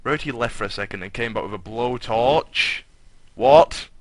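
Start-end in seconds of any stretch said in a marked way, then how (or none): 3.3-3.9 sudden loud unvoiced what; shocked what. Real, not acted. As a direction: gasp out a stunned what. Shocked at the text.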